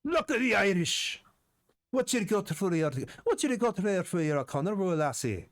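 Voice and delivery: high, bright-pitched mock Irish brogue; hammed-up Irish accent asking for the roll. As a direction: play it as a jolly Irish GM voice. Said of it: "That was a perfect Irish accent".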